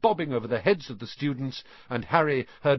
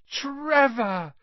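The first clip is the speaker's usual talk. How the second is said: high, joyful cry of recognition; blissful cry; 4 dB louder than usual. A narrator's reading. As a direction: cry out in overjoyed relief. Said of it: "cried Neville blissfully".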